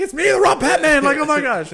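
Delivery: very high, loud laughing shriek; laughing as the drunk friend throws him out; laughing squeal. Retold or imitated. Desperate squealed plea.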